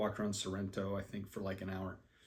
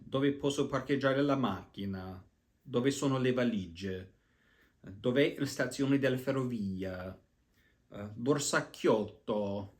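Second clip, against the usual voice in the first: Italian phrases from memory. Shown as a demonstration. Reciting old Italian course phrases in careful learner's Italian. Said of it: "some of the phrases I had to learn".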